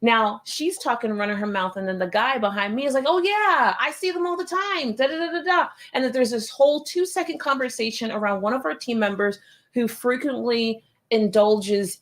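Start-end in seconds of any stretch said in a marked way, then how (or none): 3.1-5.7 high-pitched imitation of a bystander; eager chiming in. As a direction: chime in brightly, mimicking another customer. Imitates guy behind her chiming in.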